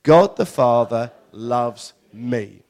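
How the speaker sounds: loud, very slow unison declaration; measured, loud group affirmation; declared together. Real, not acted. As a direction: proclaim it loudly and slowly with the congregation. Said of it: "we'll say it together".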